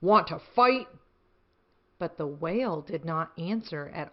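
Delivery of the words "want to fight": grouchy ladybug. Acted. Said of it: "said the grouchy ladybug".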